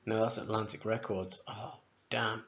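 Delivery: normal talking voice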